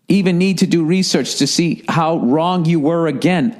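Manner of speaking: ordinary talk, nothing marked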